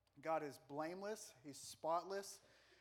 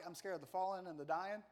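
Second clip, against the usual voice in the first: usual speaking voice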